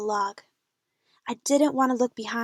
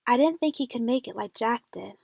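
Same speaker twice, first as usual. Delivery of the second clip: usual speaking voice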